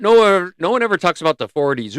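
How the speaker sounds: sudden loud exclamation; excited outburst. Real, not acted. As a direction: burst out in disbelief.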